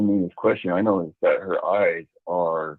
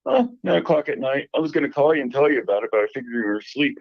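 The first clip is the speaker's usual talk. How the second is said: higher-pitched imitation of his sister, casual; imitating sister; 6 semitones higher, faster than usual. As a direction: quote the sister in a lifted, higher voice. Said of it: "And she's like".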